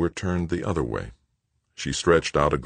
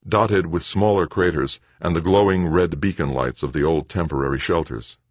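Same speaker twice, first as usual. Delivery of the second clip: plain reading voice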